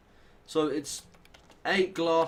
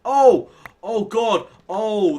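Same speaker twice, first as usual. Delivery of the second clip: loud, high burst easing slowly down; real alarm turning to relief; startled outcry; 8 semitones higher, 12 dB louder, much slower than usual. Real, not acted. Gamer after a near mishap with his axe, startled.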